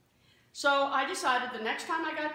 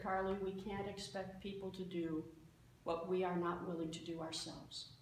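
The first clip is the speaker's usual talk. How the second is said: calm; 3 semitones lower, 7 dB quieter than usual. Retold or imitated. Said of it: "just looked at me very calmly and said".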